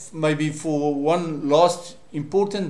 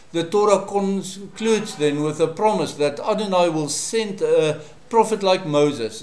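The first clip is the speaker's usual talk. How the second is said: ordinary delivery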